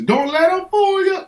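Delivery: very high, sung-out catchphrase; a hammed-up high-voiced Saint Swolmy; character catchphrase. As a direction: toss off the legend's signature line. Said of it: "his catchphrase is".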